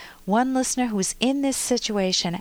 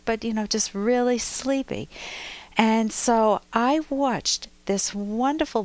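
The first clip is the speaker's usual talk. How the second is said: ordinary delivery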